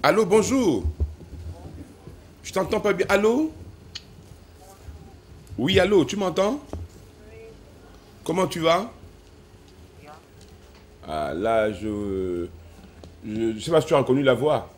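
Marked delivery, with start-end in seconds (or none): 0.0-8.9 loud, high phone voice; shouting on bad phone line. Real, not acted. 13.3-14.7 shouting on bad phone line. Real, not acted.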